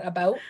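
"about" said the Canadian way; imitated Canadian "about"; Canadian pronunciation. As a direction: give "about" a Canadian vowel. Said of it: "very Canadian moment".

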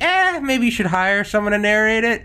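high-pitched, hesitant hedge; politely doubtful, pitched well up; tactful hedging. Acted. Host gently steering a poor narrator elsewhere, tactful hedging.

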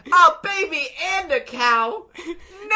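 high, slow, hammed-up wail; mock horror. Eater reacting to brother's reveal, mock horrified wail. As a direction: cry out in comic despair through laughter.

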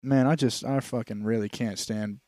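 ordinary talk

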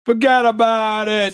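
put-on slow, high, loud Italian-American mob accent; hammed-up Italian mobster impression. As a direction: lean hard into a Brooklyn-Italian wiseguy. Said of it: "do an impression of an Italian accent".

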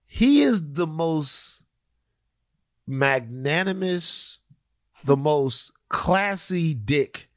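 slow, drawn-out admiration; savoring praise. Real, not acted. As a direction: stretch each word, relishing it. Praising Steph's swagger, drawn out.

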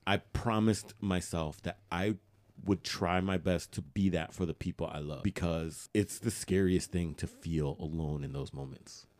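ordinary talk

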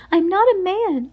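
high, loud fake lady voice; ridiculous high-pitched voice. A narrator's reading. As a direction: Olaf squeaking in disguise. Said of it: "in his disguised voice".

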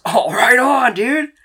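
overjoyed high-pitched dude; excited Dean. Acted. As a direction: gush like a delighted nerd. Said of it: "He looks the most excited".

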